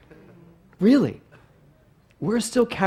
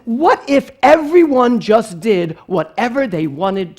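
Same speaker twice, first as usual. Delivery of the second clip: slow, loud, higher-pitched reprimand; lecturing mother; 6 semitones higher, 9 dB louder, slower than usual. Retold or imitated. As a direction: imitate a mom hammering home a moral.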